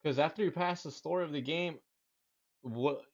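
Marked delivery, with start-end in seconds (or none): none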